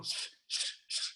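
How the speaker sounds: hushed shushing. Retold or imitated. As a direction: shush the noisy crowd. Shushing the noisy audience.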